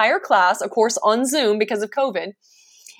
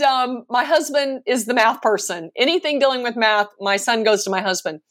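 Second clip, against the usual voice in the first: usual speaking voice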